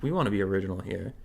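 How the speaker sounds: ordinary talk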